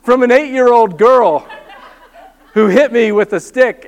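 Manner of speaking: laughing through the words; laughing while telling. Real, not acted. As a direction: chuckle at the absurd memory. Laughing at own story.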